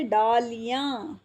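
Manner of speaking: डालियाँ drawn out slowly with long ee, daa-lee-yaan; लंबी ई. Shown as a demonstration. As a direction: stretch the middle of डालियाँ to a long ee. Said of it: "हम डालियाँ नहीं बोलते". (डालियाँ में ली खींचकर)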